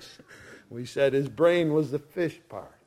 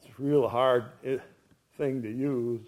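ordinary talk

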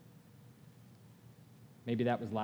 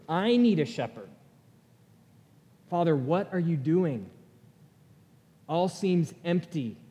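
raised, pleading voice reading a desperate prayer; anguished journal prayer; 3 semitones higher, 3 dB louder than usual. Acted. Pastor reads his journal prayer to the church, raised and pleading. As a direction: cry out the questions to God, slowed and urgent.

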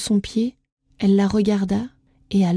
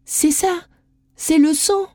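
high, elated realization; the final answer breaks free; excited; 7 semitones higher than usual. A narrator's reading. Isadora solving the last riddle, high and elated.

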